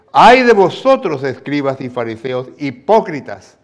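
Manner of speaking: loud, high, slow denunciation; preacher voicing Christ's woe; Jesus rebuking. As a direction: hurl "hipócritas" at them. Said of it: "¿Cómo sentiría usted si alguien le dijera hipócrita?".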